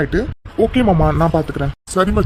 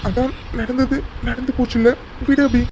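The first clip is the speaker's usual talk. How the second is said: high, mock-tearful brush-off; put-on hurt; 7 semitones higher, 3 dB quieter than usual. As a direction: feign wounded forgiveness in a high voice. Keeps up the pretended tears. (பொய்யான சோகத்தோடு உயர்ந்த குரலில்)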